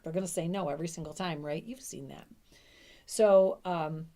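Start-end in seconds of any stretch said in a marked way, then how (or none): none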